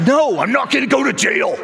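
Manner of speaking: high-pitched, worked-up mock protest; mock exasperated dad. Dad answering his crying kids in the car, mock exasperated.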